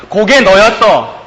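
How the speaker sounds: slow, loud and high pronouncement; slow heavy verdict of God; God's verdict. Acted. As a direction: land each word as judgment. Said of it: "라는 말을 하나님께서 하고 계십니다".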